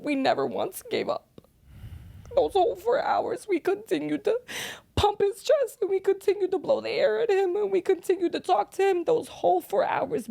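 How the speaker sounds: real grief, words squeezed out while crying; mother reliving her son's death, crying and pushing through. Real, not acted. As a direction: voice breaking high and strained through tears. Mother recalls son's last hours.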